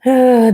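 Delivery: loud, heavy sigh. Real, not acted. Reader before resuming the grim story, loud sigh. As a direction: let out a big audible breath.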